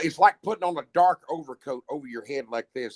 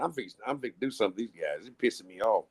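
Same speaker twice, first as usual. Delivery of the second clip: inner angry thought; 5 semitones lower, 4 dB quieter, faster than usual. Retold or imitated. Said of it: "'cause I'm already thinking".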